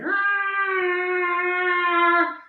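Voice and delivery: long, high-pitched cat cry; imitated cat yowl. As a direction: let out a drawn-out high cat screech. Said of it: "Every time I do my cat sound".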